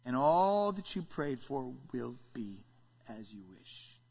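slow, low, sinking to very soft; God speaking. Acted. God grants what was prayed for, solemn low voice. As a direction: grant it slowly in a hushed low voice. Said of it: "And God says".

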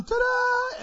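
big high showman's ta-da; ta-da flourish. Acted. Preacher playing herald, a high showy ta-da. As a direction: unveil him like a magic trick.

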